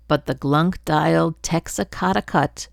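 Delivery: plain reading voice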